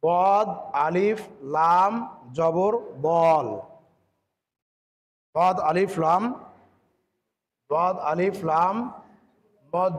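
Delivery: letter by letter, drawn out; slow spelling out. Shown as a demonstration. Teacher spelling the word slowly for the class. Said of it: "বানানটা যখন করবেন".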